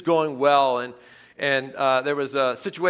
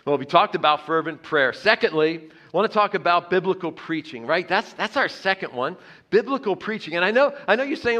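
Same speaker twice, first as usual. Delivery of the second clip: normal talking voice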